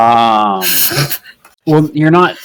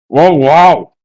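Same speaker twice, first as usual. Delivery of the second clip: louder impressed exclamation; impressed giant; 6 semitones higher, 7 dB louder than usual. Acted. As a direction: wow it out as a giant. Goliath tastes the fish.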